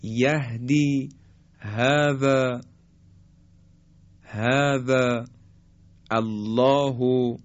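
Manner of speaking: هذا slow, with a light, open h and no throat tension; open breathy h. Shown as a demonstration. Teacher contrasting the light ه with the ح. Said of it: "پس های دو چشم مثل".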